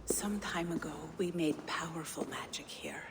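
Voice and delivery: eerie breathy whisper; a secretive, otherworldly whisper. Acted. Gunnlodr whispering to Havi about old magic, eerie.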